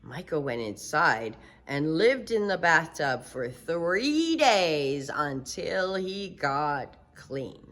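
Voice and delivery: ordinary delivery, nothing marked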